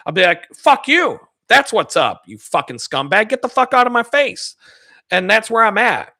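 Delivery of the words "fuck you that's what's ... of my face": imagined angry retort. Pretend. Imagined confrontation with ex-coworker.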